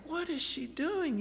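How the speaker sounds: puzzled while half asleep; confused. Retold or imitated.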